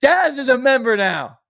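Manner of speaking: high, loud booming call-out; hammed-up wrestling announcer yell; mock announcer shout. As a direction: bellow it like a ring announcer. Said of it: "I said".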